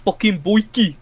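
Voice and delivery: a quick mock South African accent, barked out. Gamer quoting District 9 to mates, mock South African accent. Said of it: "I can't do a South African accent".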